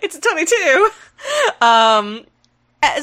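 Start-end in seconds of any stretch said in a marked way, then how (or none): 0.0-0.9 high, giggling repeat; laughing imitation. Host repeats the chicken cosplayer line, laughing. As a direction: say it while cracking up. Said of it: "cosplayer holding a chicken going".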